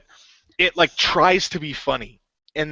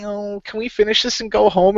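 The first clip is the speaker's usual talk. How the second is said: higher-pitched whining complaint; whiny; 6 semitones higher than usual. Retold or imitated. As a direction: imitate a whiny dragon wanting out. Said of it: "like, whiny".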